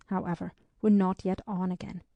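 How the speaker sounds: ordinary delivery, nothing marked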